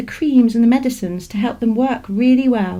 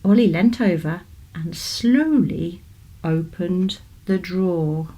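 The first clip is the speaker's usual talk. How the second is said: ordinary delivery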